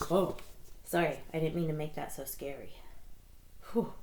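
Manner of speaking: usual speaking voice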